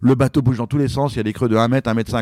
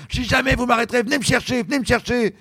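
high mock shout of defiance; defiant yell; 10 semitones higher than usual. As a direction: dare them to come get him. Swimmer defying the boat again.

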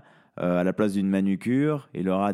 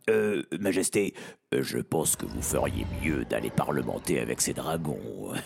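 timid advisor voice; 10 semitones lower, 4 dB quieter than usual. Acted. Advisor Brovure voiced in story.

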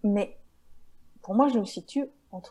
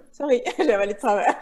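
laughing; 3 semitones higher, 9 dB louder, faster than usual. Real, not acted. Recovering from choking, laughing.

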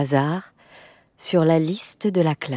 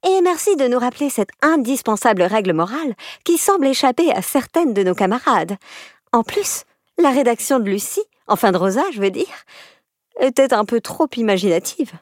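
higher, cheeky mock-polite schoolgirl; insolent sweetness; 6 semitones higher than usual. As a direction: needle them with fake politeness. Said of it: "avec un culot hallucinant".

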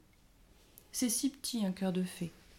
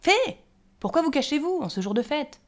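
higher and louder jolly gnome voice; loud cheerful call; 8 semitones higher, 9 dB louder than usual. A narrator's reading. The gnome calls the hiding fairies to the feast, loud and merry. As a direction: call in from the doorway, full of cheer. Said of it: "cria une voix forte".

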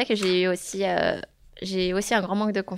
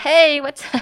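lightly mimicking a carefree hello, pitched up and louder; breezy casual greeting; 7 semitones higher, 8 dB louder, slower than usual. Greeting colleagues in a meeting, casual and carefree. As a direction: toss it off like a relaxed colleague. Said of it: "Je suis vraiment en mode détendue".

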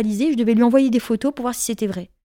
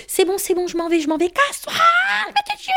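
much higher, squeaky mock panic; panicked pleading; 12 semitones higher than usual. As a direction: imitate a scared man backing off. Le mec paniqué qui s'en va. (homme paniqué qui supplie, voix très aiguë)

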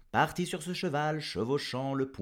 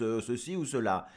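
ordinary delivery, nothing marked

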